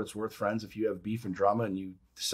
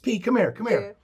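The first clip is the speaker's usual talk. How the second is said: higher-pitched call, easing off on the repeat; imitated summons; 10 semitones higher than usual. Gangster summoning the kid, imitated call. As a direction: beckon him over in a retold scene.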